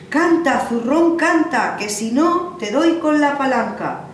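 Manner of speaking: loud, high bellow at the sack; threatening shout. Acted. Old man threatens the magic sack, loud high shout. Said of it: "cogía un palo y decía".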